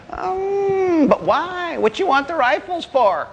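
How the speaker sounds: put-on high, puzzled old-timer; old man. Old man asks hunters about rifles, high puzzled old-timer voice. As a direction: question them in a high old granddad voice.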